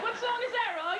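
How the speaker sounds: a hammed-up Harvey asking. Harvey Keitel asks about her humming.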